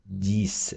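teacher's clear demo of діс; French word spoken. Shown as a demonstration. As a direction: say діс for dix, a clean French ending. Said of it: "десять читається як".

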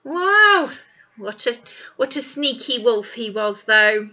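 usual speaking voice

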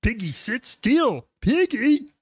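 high, slow puppet frog voice; Kermit voice. Acted. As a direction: scold like a fussy cartoon frog. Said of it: "doing Kermit right now".

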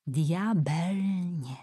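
"Diabelnie" drawn out very slowly, letter by letter, hushed; spelled out in a hushed voice. Acted. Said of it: "Ursula szeptem przeliterowała straszliwe słowo".

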